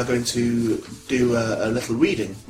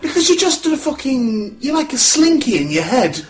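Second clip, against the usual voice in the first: ordinary delivery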